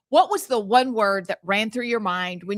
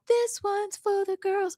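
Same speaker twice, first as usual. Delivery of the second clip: [name] quote; 10 semitones higher, 4 dB quieter than usual. Retold or imitated. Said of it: "[name]".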